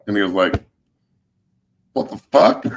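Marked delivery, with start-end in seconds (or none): 1.9-2.6 incredulous reaction. Retold or imitated. Quoting shocked reaction to odd request.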